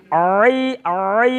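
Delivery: long drawn-out "আই, আই"; elongated letter sound. Shown as a demonstration. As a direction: stretch "আই" out slowly, throat sound. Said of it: "আর একটু চেষ্টা।". (টেনে লম্বা করে "আই" উচ্চারণ)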